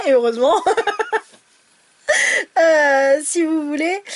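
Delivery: normal talking voice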